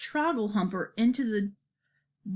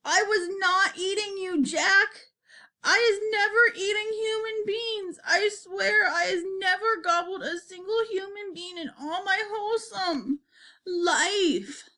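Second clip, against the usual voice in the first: high, tearful wail of denial; terrified giant swearing innocence; desperate screeching; 11 semitones higher than usual. A narrator's reading. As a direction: protest in a high, panicked screech. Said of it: "he screeched".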